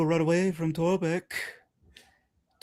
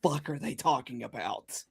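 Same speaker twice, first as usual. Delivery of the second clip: muttered confusion; 5 semitones lower, 11 dB quieter than usual. Retold or imitated. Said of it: "Sable's just like".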